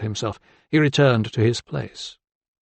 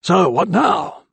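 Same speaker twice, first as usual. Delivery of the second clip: high, slow, savoured question; smug old man; 6 semitones higher, 4 dB louder, slower than usual. A narrator's reading. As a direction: draw it out like a smug old doctor. Old man savouring his point.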